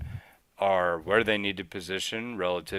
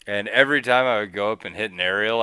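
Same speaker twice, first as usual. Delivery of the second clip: usual speaking voice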